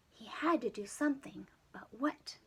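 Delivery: plain reading voice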